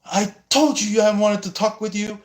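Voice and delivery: mock pouting. Pouting third party demanding attention, high and sulky. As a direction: pout it like a spoiled child.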